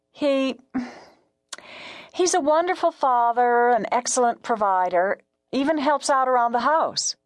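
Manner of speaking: high, tentative woman caller; timid caller pitched high; hesitant radio caller. A narrator's reading. Caller praises husband haltingly.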